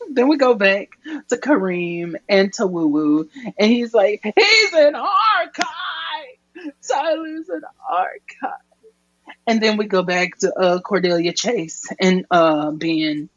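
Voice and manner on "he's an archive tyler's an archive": hammed-up stretched-out exclamation; mock anguished cry. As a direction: imitate a melodramatic revelation at high pitch. Kareem cries out a revelation.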